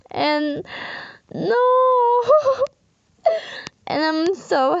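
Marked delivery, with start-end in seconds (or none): 1.6-2.7 tearful laugh. Real, not acted.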